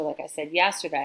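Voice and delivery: usual speaking voice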